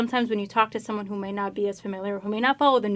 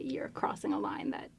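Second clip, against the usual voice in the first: whisper; 2 semitones lower, 6 dB quieter than usual. Real, not acted. Posing question, quoting a stance.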